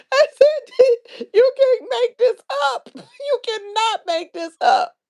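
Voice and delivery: very high, squealing through laughs; real laughing fit, crying with laughter; helpless laughter. Real, not acted. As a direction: barely get the words out for laughing. Helpless laughter retelling the story.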